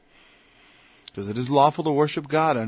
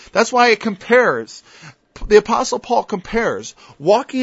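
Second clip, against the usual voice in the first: louder, higher preaching, pressing each point; fiery preaching; 6 semitones higher, 6 dB louder than usual. Acted. Preacher arguing salvation needs resurrection, fiery. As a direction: hammer the resurrection point home to the flock.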